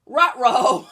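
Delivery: playful dog-voice catchphrase; Scooby-Doo. Shown as a demonstration. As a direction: yelp it as a talking dog. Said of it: "Can you do Scooby-Doo impressions".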